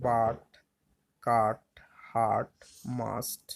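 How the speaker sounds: বাট, কাট, হার্ট, মাস্ট each with an open "aa" vowel; U pronounced "aa". Shown as a demonstration. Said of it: "আয়ের মতন হবে". (বাট, কাট, হার্ট, মাস্ট-এ U-এর উচ্চারণ "আ")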